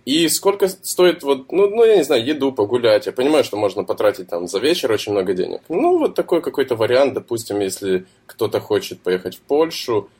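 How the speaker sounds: ordinary delivery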